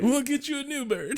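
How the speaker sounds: high, put-on soothing voice; mock consoling. Spike promising Drusilla a new bird, mock consoling. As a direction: coax like placating a sulking child.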